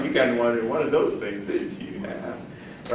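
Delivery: low, hushed teasing suspicion; knowing skeptic. Acted. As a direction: trail down softly, as if you've seen through it. Skeptical prospect reacts to pitch.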